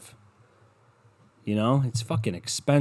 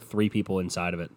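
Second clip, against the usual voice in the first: usual speaking voice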